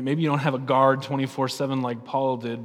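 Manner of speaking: usual speaking voice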